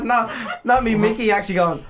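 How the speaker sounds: ordinary delivery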